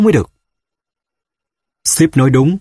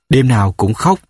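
ordinary delivery

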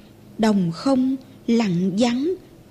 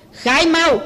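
soldier roaring; 4 semitones higher than usual. Acted. Soldier repeats his demand to the mother, barking "khai mau" higher. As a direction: snap "khai mau" up high with a hard falling "mau". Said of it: "Hắn rống hét".

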